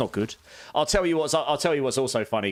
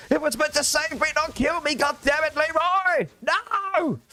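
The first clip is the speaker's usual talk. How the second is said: despairing dying hero. Pretend. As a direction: cry out as if your hardcore hero just died. Acts a hardcore player dying to a potion.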